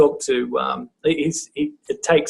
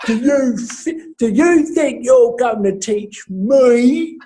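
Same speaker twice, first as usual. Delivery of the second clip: high, slow, drawn-out mocking challenge; challenging the teacher; 8 semitones higher, slower than usual. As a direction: drag out a taunting student's question. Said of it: "Like this, I don't get that".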